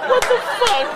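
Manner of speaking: high squeaky laughing voice; laughing disbelief. Real, not acted.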